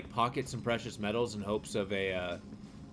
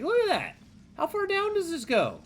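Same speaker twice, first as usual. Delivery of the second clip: amazed; 4 dB louder than usual. Real, not acted. Amazed at huge mine depth.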